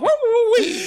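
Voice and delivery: high, loud woo woo wee; silly whoops. Pretend. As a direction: whoop like a giddy kid. Silly excited cry.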